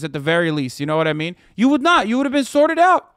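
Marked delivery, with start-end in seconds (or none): 1.5-3.1 shouting higher and much louder, full of contempt; furious shout. Real, not acted. Yelling at state legislators who say let God sort it out, furious shout. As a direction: blast him as a scumbag at the top of your voice. Ranting at anti-abortion legislators.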